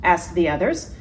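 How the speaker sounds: plain reading voice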